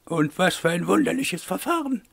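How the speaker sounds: high put-on voice of a lady; critical mademoiselle. A narrator's reading. Eloire finds Wolf's method peculiar, high critical lady voice. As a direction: marvel critically, pitched high.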